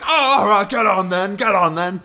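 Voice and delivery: very high, loud British officer; British accent. Retold or imitated. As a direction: shout it in hammed-up British. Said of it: "what happened both times".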